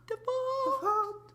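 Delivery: long, very high cracked squeak; exaggerated voice crack. Shown as a demonstration. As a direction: stretch it out in a cracking falsetto. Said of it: "I still have the voice cracks".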